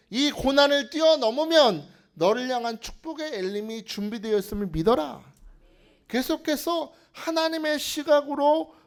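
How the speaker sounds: loud, high-pitched proclamation; full-force preaching. Acted. Pastor urging the congregation to trust God, forceful preaching.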